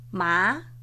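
MA gliding upward in second tone. Shown as a demonstration. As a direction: Mandarin MA with a rising tone. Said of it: "Segundo tono".

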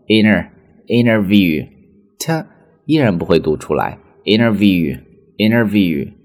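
interview said as inner-view, t dropped; American inner-view; flapped interview. Shown as a demonstration. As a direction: drop the t in interview.

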